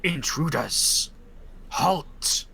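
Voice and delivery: slow, booming spirit challenge; a bone creature ordering intruders to stop. Acted. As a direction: guardian spirit barking a halt. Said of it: "says in first tongue".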